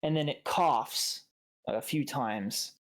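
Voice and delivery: usual speaking voice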